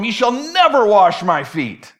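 "never" drawn out high and loud; defiant stress on NEVER. Acted. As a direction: refuse outright, punching "never". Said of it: "picking a fight with the creator".

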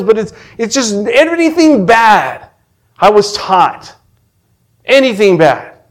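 high, loud preaching cry; fervent confession pitched high; impassioned testimony. Real, not acted. Testifies at full force.

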